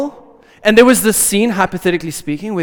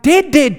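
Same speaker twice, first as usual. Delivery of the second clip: dead, dead punched higher and louder; emphatic repetition; 6 semitones higher, 5 dB louder than usual. Acted. Preacher insisting to the congregation that Jesus truly died, emphatic. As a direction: hammer the word dead, dead. Stressing real death.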